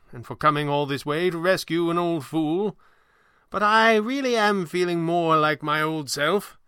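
put-on high, theatrical old magician, gracious; high-pitched, stately stage performer; old showman. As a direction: decline grandly, with slow flourishes. Voices Falcone declining the offer.

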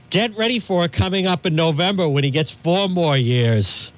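ordinary talk

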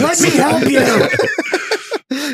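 high, drawn-out comic begging; mock desperate plea. Joker begging to help the caller, mock desperate. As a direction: stretch it out in a high pleading voice. Acted pleading phone-call bit.

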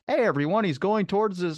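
mock hey everyone announcement; mocking announcer. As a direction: parody someone pointing out the joke. Mocking comedians pointing out jokes.

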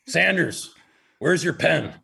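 calling out a player by name; coach yelling. Retold or imitated. Coach calling out his team leader, demanding. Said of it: "And he goes".

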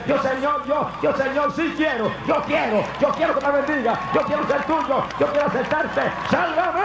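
high, pleading prayer of a repentant sinner; high, earnest plea for salvation. Acted. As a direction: beg the Lord to save you. Sinner's prayer voiced for listener.

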